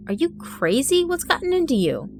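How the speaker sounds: shocked outburst. Acted. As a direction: burst out in alarm at his friend. Quincy shocked by madman.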